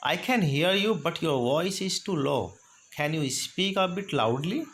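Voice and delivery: slow, deliberate request; slow model phrase. Shown as a demonstration. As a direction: say it slowly for the class. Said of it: "तो sentence कैसे बोलेंगे?".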